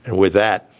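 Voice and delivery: usual speaking voice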